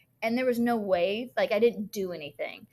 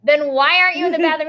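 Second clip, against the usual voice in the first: mock teacher challenge; 5 semitones higher, 11 dB louder, faster than usual. Retold teacher challenging a stray pupil.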